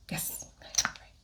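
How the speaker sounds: soft whispered aside to herself. Real, not acted. Vlogger confirming shade to herself, whispered. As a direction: breathe it, barely voiced.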